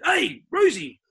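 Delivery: high, loud call of the name; imitating Sheeds calling out. Coach spotting a rival player in his rooms, high loud call. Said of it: "and Sheeds said".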